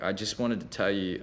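ordinary talk

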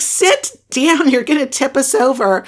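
high-pitched urgent order; urgent scolding. Retold or imitated. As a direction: scold a kid standing up in a boat. Retold scolding of boy standing in boat.

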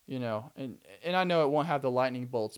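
ordinary delivery

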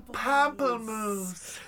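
high, slow, distant call; from another room. Acted. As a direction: call it out from far away. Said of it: "Shouting the flavor from the other room".

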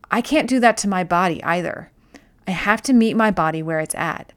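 normal talking voice

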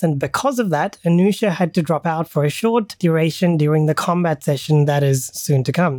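normal talking voice

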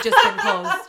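high, loud, drawn-out protest; slow, high mock whine of excuse; mock defensive. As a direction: imitate a defensive waitress, laughing. Acted sister's defence at border.

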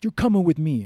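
loud, high, barked order; angry guard. Retold or imitated. Furious security guard orders the traveller, loud. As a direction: grab him with the words. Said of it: "And he's like".

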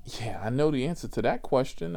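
ordinary talk, nothing marked

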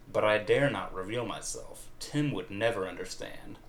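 ordinary delivery

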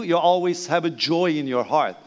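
ordinary delivery

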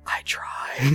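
soft unvoiced whisper. Ariel whispering to dad during the photo, hushed. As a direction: lean in and whisper it. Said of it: "she whispers over to me".

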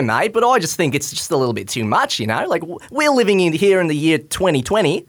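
lightly hammed Aussie accent; mild mock Australian accent; put-on Australian accent. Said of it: "putting on this accent for the knighthood".

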